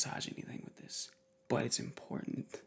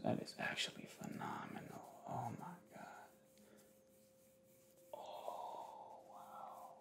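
much softer, awed whisper; amazed whisper; 16 dB quieter than usual. Real, not acted. Vlogger amazed by the massager, soft awed whisper. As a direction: whisper in wonder at the sound. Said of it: "I get my A S M R on".

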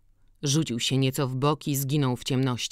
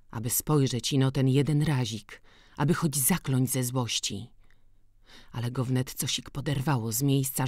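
usual speaking voice

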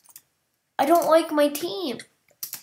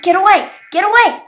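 loud, higher shouted warning; angry shout; 7 semitones higher, 13 dB louder, much faster than usual. Real, not acted. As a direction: drive the thief off. Chasing off a player.

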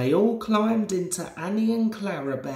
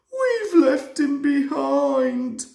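higher, louder, slow wailing cry; sobbing shout; 8 semitones higher, 6 dB louder, slower than usual. A narrator's reading. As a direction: coaches wailing that the guard is gone. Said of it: "sobbed Annie and Clarabel together".

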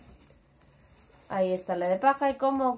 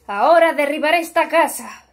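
menacing wolf; 4 semitones higher, 8 dB louder than usual. A narrator's reading. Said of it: "dijo el lobo".

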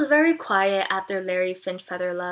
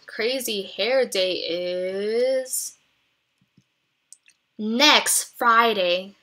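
teacher voice; 4 dB louder, much slower than usual. Acted. Teacher's announcement in story.

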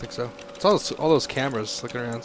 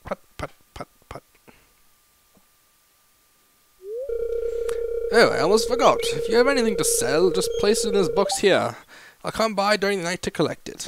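animated small-town official voice; slightly raised, hearty character voice; mayor character, kindly advising; 7 semitones higher than usual. Acted. Mayor sends the tired newcomer to rest, hearty official voice.

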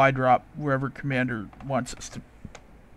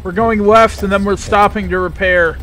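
loud callout; 4 semitones higher, 7 dB louder than usual. Real, not acted. Shouting over tank noise.

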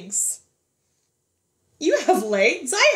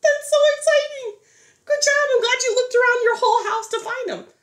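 excited praise; 11 semitones higher, faster than usual. Real, not acted. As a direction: celebrate their find, high and bright. Teacher praising children.

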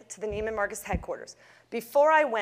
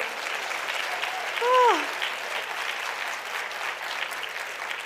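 relieved sigh; 13 semitones higher than usual. Real, not acted. Overwhelmed relief after the meeting.

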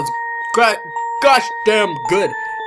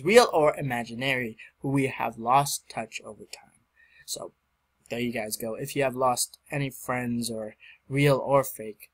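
sincere; 8 semitones lower, 7 dB quieter than usual. Real, not acted. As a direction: speak it gently from the heart. Sincere dedication message.

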